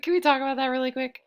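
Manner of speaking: plain reading voice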